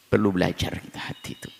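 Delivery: usual speaking voice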